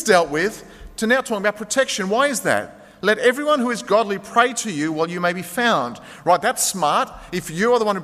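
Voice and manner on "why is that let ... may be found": ordinary talk, nothing marked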